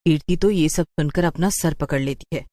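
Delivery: usual speaking voice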